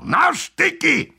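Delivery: high, drawn-out battle cry; officer's command, pitched far up; called-out order. A narrator's reading. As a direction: send the troops charging. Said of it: "zawołał".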